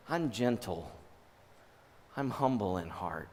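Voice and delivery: soft, low reassurance; gentle and humble. Acted. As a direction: say it tenderly, low and unhurried. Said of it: "Do you hear the difference".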